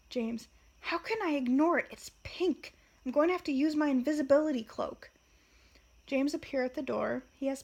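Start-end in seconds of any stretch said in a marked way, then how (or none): 0.8-5.1 put-on loud, high indignant teenage outburst, fast at the end; loud, indignant, quickening at the end; teen's roar. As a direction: wail in outrage over pink hair. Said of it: "We hear a roar from James".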